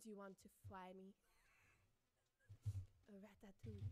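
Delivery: silly mock French accent. Shown as a demonstration. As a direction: ham up an amateur French accent. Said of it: "I am French".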